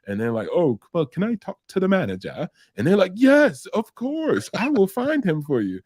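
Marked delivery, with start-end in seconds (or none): 0.5-2.5 high-pitched mock British caller; British phone-caller impression; imitated British accent. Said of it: "he had a accent". 3.1-5.8 very high, mock-sweet receptionist; eagerly obliging. Eagerly helpful call recipient, acted.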